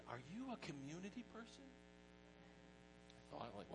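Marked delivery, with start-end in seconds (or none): 0.1-1.6 a mild imitation of a church lady, higher; lightly voiced church lady asking brightly. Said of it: "and said".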